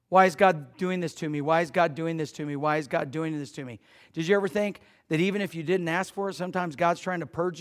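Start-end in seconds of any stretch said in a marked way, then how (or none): 0.1-3.8 mock whine fading quieter each time; sulky victim repeating it, dying away; fading complaint. Believer blaming God, a mock whine fading to near silence. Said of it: "we start blaming him".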